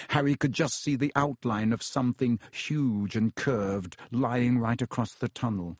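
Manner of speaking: usual speaking voice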